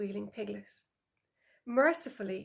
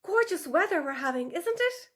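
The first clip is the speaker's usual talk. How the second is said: higher, louder, overly cheerful chatter; forced small talk; 8 semitones higher, 5 dB louder than usual. A narrator's reading. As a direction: Tula covers her slip with bright chat.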